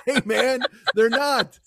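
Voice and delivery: a giggly voiced knee joint; laughing knees. Knees answering the body, half laughing.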